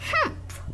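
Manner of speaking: short huffy snort through the nose; grumpy snort. A narrator's reading. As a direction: huff it out like a sulky child. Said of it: "Grumpy little Sally snorted".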